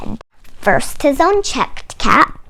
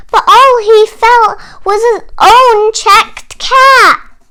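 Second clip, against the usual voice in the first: 1 dismayed; 8 semitones higher, 8 dB louder than usual. A narrator's reading. Shock of missing caps.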